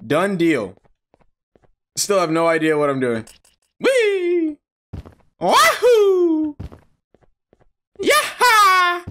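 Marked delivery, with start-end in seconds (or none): 3.8-9.0 very high gleeful whoops; wahoo yell. Real, not acted. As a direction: whoop like jumping for joy. Jumping in game.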